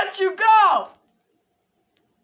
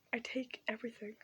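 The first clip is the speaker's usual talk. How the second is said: whisper; 10 semitones lower, 24 dB quieter, faster than usual. Pretend. Fake sad confession, whispered take.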